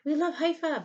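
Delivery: high and loud mock-gushing. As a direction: sneer their fake love back at them. Mocking their claimed love.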